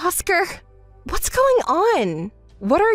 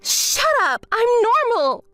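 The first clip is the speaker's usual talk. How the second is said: angry; 7 semitones higher than usual. Acted. Angry denial.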